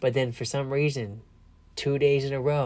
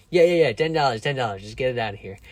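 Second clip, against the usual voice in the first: mock impatient seller; 7 dB louder, faster than usual. Seller caves, imitated loudly.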